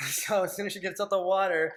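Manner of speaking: normal talking voice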